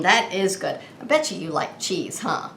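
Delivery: normal talking voice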